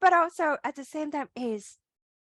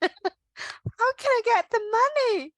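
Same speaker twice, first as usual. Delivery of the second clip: panicky, laughing, higher and slow in places; mock freaking out; 8 semitones higher, slower than usual. Host mimics the human who panics after a prayer is answered, laughing mock panic. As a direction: play the scared human, laughing through the panic. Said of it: "But then you freaking out".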